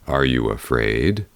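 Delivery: low, kind bear asking; gentle bear voice. A narrator's reading. Bear asking the girl before the ride, low and kind. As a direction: speak as a big calm bear.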